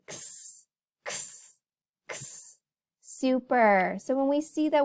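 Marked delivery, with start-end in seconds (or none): 0.1-2.6 whispered kss like knitting needles clicking; unvoiced kss, needles tapping together. Shown as a demonstration. Teacher shows the needle sound to kids, whispered kss. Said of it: "and they say".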